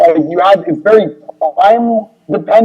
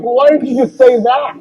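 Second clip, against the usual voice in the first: mock exasperated; 8 semitones higher than usual. Retold exasperated question.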